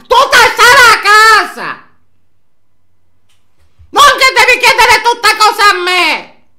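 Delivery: furious yell, voice cracking high; angry shouting. Real, not acted. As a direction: shout her down in real anger. Scolding Filomena who asks for everything. (urlando arrabbiata)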